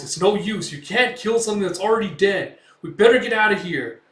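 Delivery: high, loud, urgent warning; panicked pleading. Retold or imitated. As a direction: urge a stubborn companion to flee. Companion urges Guts to flee.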